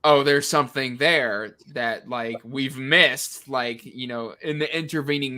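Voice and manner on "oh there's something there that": usual speaking voice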